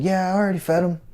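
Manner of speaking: put-on kid answering. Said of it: "And I said".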